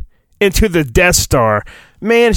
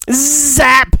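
high zapping effect cry; zap sound effect; 8 semitones higher than usual. Acted. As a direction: spit out the zap like lightning. Force lightning sound effect.

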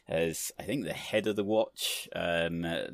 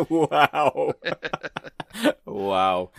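laughing amazement; 8 semitones higher, 13 dB louder than usual. Real, not acted. Stunned by the Skye joke.

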